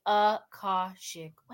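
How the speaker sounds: "Akashic" slowly as uh-KAH-shick; repeat-after-me pronunciation. Shown as a demonstration. Host leading everybody to repeat, slow careful "Akashic". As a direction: spell out "Akashic" syllable by syllable, slowly. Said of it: "Everybody repeat after me".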